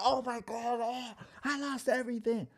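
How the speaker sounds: high panicked cry, replayed; mock panic. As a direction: wail over losing everything. Retelling panic after losing money.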